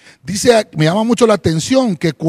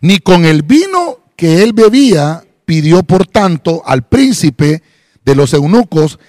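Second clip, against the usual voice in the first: slow, slightly louder Bible reading; scripture reading; 3 dB louder than usual. Acted. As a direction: read the verse deliberately. Reads Daniel from Reina Valera. (lectura bíblica pausada)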